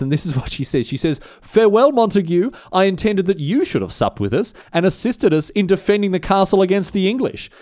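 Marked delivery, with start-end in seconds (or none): 1.2-7.5 high, raised mock-grand call; mock yell down. Agnes taunting the troops from the castle, mock yell. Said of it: "she yells down to William".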